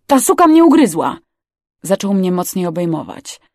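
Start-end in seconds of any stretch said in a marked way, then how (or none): 0.1-1.2 high, loud, indignant cry; outraged yell. Acted. Bitten crewman complaining to the others, outraged yell. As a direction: cry out in shock at being bitten.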